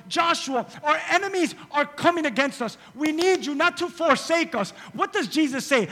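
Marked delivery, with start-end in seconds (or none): 0.1-4.7 loud, high preaching. Acted. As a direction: preach with urgency. Preaching at full force.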